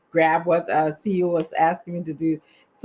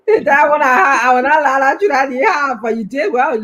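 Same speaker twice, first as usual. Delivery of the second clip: laughing; 6 semitones higher, 7 dB louder than usual. Real, not acted. Laughing reply to the teasing.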